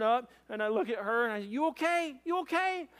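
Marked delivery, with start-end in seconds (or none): 1.5-2.9 high-pitched anxious check; retold urgent check, voice jumping up; urgent concern. Husband checks his wife after the crash, high and urgent.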